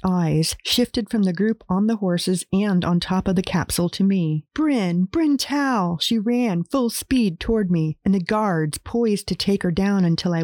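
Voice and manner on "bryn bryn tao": high call of relief. Acted. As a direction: call his name from afar. Neighbor calls his name.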